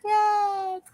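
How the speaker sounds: high, plaintive cat calling for dad; whiny cat voice. Pretend. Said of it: "Nimbus is like".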